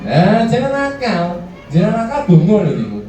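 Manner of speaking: put-on high, drawn-out scolding of a child; scolding parent. Shown as a demonstration. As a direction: play the nagging parent who keeps saying don't be naughty. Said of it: "ini cara nanganin anak". (orang tua yang memarahi anak, suara tinggi dan lambat)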